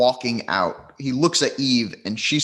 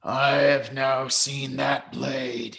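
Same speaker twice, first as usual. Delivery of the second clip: slow, deliberate character voice; slit-throated man; slower than usual. Acted. Slit-throated man answering about the sword, slow and solemn. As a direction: space the words out gravely. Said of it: "he says".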